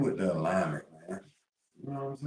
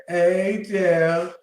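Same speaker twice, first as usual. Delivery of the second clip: higher, louder mock greeting; imitating Serenity; 9 semitones higher, 6 dB louder than usual. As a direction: say it like Serenity did. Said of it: "Serenity said".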